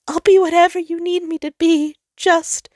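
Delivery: high, loud pleading that breaks off; beg, then stop short; desperate offer cut off mid-word; frantic plea. Acted. Sol pleads, then cuts off.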